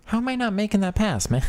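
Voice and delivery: very high-pitched, incredulous complaint; exasperated high voice. Real, not acted. Gamer to himself after a missed pass, exasperated. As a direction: squeal the frustration, far above normal pitch.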